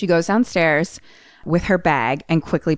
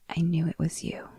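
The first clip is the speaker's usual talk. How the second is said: very soft whisper; 10 dB quieter than usual. Acted. Babysitter touching boy's face, whisper. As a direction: whisper it as a chilling reveal. Villain's quiet reveal to boy.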